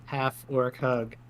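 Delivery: ordinary talk, nothing marked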